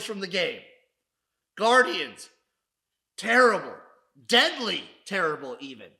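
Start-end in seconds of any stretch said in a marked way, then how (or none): 1.6-5.9 punched-out loud verdict. Acted. Host loudly summing up the losing team. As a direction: bark the verdict word by word.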